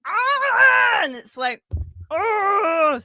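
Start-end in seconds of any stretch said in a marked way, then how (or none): 0.1-1.0 high, loud, drawn-out "Ah!"; imitated battle scream. Fan acting out Mariah slamming her fist, imitated scream. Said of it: "she's just like". 2.1-3.0 high, drawn-out "Oh!"; carried-away mock battle cry; excited imitated yell. Excited fan acting out the wrestler at the mic, imitated yell. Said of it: "Sorry, I get so excited".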